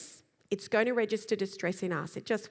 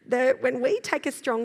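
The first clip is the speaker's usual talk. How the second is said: normal talking voice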